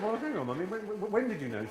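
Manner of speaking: high, incredulous questioning; startled son. Retold or imitated. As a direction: stammer in disbelief. His retold startled question.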